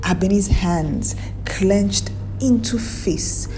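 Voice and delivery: ordinary delivery, nothing marked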